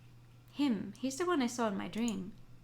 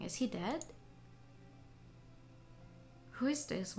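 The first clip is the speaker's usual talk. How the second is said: usual speaking voice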